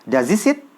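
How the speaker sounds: "Does he sit" blended as "daz i sit"; Z sound in does, H of he dropped; quick connected speech demo; fast linked reading. Shown as a demonstration. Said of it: "Gitu, jadi Z jadinya".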